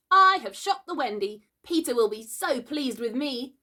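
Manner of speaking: put-on high boyish boasting; proud cry. A narrator's reading. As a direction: boast of your great deed. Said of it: "he cried proudly".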